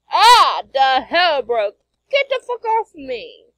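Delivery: loud, high outraged shout; startled yell. Acted. Comic character yelling to get someone off, startled shout. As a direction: cry out and fight someone off.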